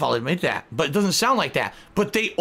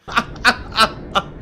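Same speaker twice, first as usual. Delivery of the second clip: airy laugh; 6 semitones higher, 3 dB louder than usual. Real, not acted. Laughs after insult.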